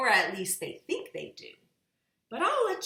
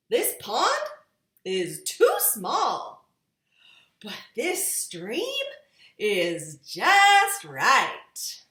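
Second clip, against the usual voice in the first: character voice; 4 semitones higher, 10 dB louder, much slower than usual. A narrator's reading. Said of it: "said somebody".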